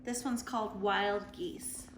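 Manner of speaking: ordinary talk, nothing marked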